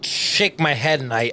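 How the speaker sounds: usual speaking voice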